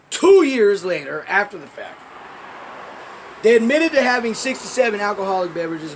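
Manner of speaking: loud, high-pitched hammering of each point; indignant emphasis. Real, not acted. Arguing a friend's innocence over one witness, loud and indignant.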